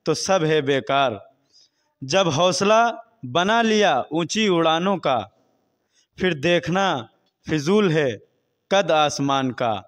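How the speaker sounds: projected, measured shayari delivery; poetry recitation. Real, not acted. Interviewee recites couplets on hunger and voting, projected recitation. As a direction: declaim the verse phrase by phrase, with pauses.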